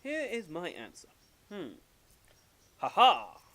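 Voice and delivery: lightly acted professor, ending in a loud aha; measured announcement, then triumphant exclamation; Professor Layton. Professor presenting his puzzle answer, self-assured. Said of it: "Professor Layton is more of a".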